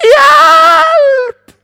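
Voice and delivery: trapped father screaming for help to staff outside; shout for help. Retold or imitated. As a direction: yell for help through the door. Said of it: "så roper jeg".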